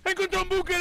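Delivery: high panicked report. Real, not acted. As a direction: blurt it out in fear. Shouting find while chased.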